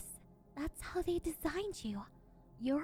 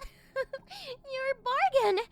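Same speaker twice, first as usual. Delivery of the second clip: gloating laugh; 9 semitones higher, 7 dB louder, much slower than usual. Acted. Mistress gloating over her cheap pet.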